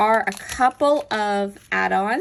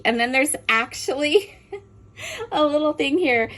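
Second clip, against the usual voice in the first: higher, laughing through the words; 6 semitones higher than usual. Real, not acted. Crafter laughing at an instruction stamp, amused. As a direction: giggle while pointing it out.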